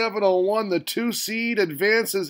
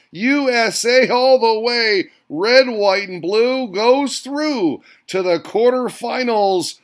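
higher, louder triumphant announcing; excited winner call; 4 semitones higher, 3 dB louder than usual. Real, not acted. Host cheering the American winner, loud and higher. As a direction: crown the winner like a boxing announcer.

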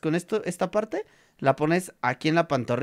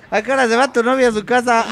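laughing mock parent; 6 semitones higher, 9 dB louder, faster than usual.

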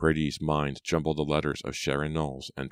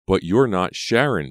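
loud, higher-pitched protest; raised-voice disbelief; 4 semitones higher, 8 dB louder than usual. A narrator's reading. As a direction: object with urgency. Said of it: "Brady protested".

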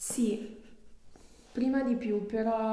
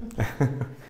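short laugh; 9 semitones lower, 6 dB louder than usual. Real, not acted. Patient reacts to strange crack.

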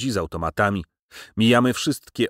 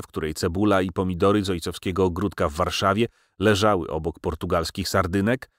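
usual speaking voice

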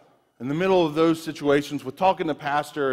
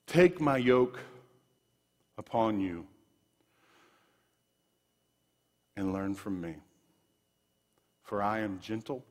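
scripture reading; 7 semitones lower, 7 dB quieter than usual. Acted. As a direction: read the verses gently, as a promise. Reads scripture, soft and slow.

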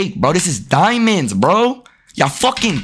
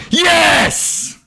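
jubilant shout; 13 semitones higher than usual. Real, not acted. Gamer celebrating a made shot, jubilant shout. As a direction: belt it out as the shot drops. Scores in the game.

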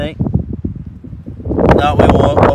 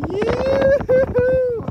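excited whoop. Real, not acted. Excited herping find, calling out.

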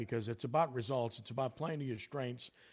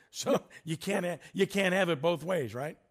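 amused, louder and higher with a laugh; 5 semitones higher, 6 dB louder than usual. Real, not acted. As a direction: laugh while making the point emphatically. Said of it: "I'm laughing because".

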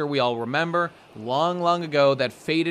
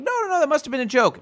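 much higher, louder, fast panicky squeal; panicked crewmen; 12 semitones higher, 5 dB louder, faster than usual. Retold or imitated. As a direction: blurt it in a nervous rush. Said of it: "And they're like".

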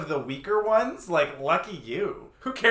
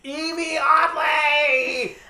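long, high, loud shout; screaming fan; 8 semitones higher, 5 dB louder, much slower than usual. Retold or imitated. Cheering a queen at the viewing party, screaming. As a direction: holler the name, stretching every syllable. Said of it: "us just screaming".

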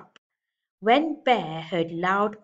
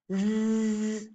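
snoring; 3 semitones higher than usual. Acted. Bear falls asleep for winter.

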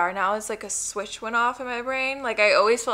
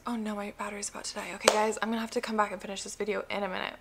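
rushed; 6 dB quieter, much faster than usual. Real, not acted. Battery dying rush.

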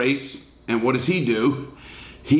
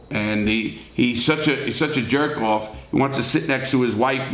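usual speaking voice

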